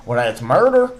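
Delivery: ordinary talk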